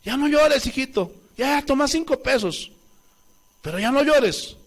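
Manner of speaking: pleading parent. Retold or imitated. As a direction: beg the kid to stop crying, half-hearted mock. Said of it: "el niño revolcándose".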